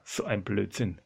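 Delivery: low, soft dismissive thought; private disbelief. Acted. Petrus privately dismisses the idea, low and soft. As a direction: say it under your breath to yourself. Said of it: "Denkt sich der Petrus".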